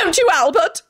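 high, strident appeal; high-pitched hysterical question; wild shriek. A narrator's reading. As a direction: demand agreement in a shrieking pitch. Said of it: "she shrieked wildly".

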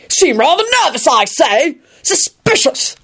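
high-pitched, officious suspicion; harsh interrogation. A narrator's reading. Guard questions nervous Neville, harsh. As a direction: accuse in a high, pompous voice. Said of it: "he intoned harshly".

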